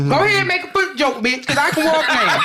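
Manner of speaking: high, fast playful taunt; mock challenge. Woman daring co-host to make a foot joke, mock challenge.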